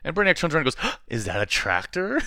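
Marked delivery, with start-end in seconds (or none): 1.1-2.2 slow, wide-eyed gasping question; dumb wonder. Retold or imitated. Podcaster mocks Brainiac's dopey reaction, slow gawking wonder.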